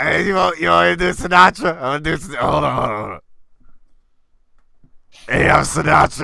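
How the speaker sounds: mock bad impressionist, one voice for everyone. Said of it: "Every fucking impression he does is [name]".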